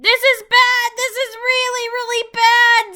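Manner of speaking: high, loud panicked shriek; panicked screaming. Acted. King Kai panicking at the sky, screaming high. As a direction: scream it, stretching the second really. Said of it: "started panicking and screaming".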